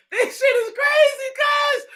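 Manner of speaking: high, disbelieving cry to the chat; incredulous exclaim. Real, not acted. Streamer tells chat, high incredulous exclaim.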